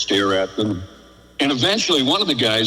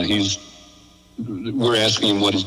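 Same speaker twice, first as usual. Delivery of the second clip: ordinary delivery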